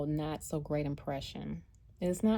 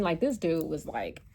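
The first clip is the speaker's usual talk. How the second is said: normal talking voice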